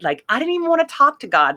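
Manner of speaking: fired up and quick, still stung; livid, reliving it. Retold or imitated. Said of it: "I was livid at this point".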